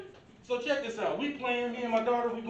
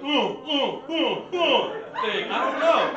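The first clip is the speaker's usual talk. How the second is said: laughing stammer; 5 semitones higher, 5 dB louder, slower than usual. Real, not acted.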